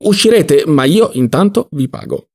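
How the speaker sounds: high, emphatic reassurance; insistent retort. Acted. Don Lollò to protesting Ziddima, insistent retort. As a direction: override his protest.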